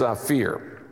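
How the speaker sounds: ordinary talk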